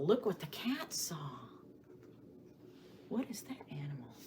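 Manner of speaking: a hushed, secretive whisper. Acted. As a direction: whisper as if not to wake anyone. Whispering over the owl picture.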